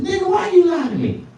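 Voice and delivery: high angry woman; angry girl. Retold or imitated. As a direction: confront him in a high voice. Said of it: "She said".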